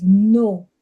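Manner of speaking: firm no. Retold or imitated. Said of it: "My first reaction was".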